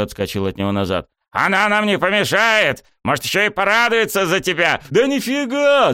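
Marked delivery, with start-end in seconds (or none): 1.3-4.8 high-pitched insistent hollering; yelling. A narrator's reading. Coach persuading retreating student, yelling. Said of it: "продолжил вопить он". 4.9-5.9 very high, loud refusal; yelling. A narrator's reading. Student keeping his distance, yelling. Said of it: "заорал я".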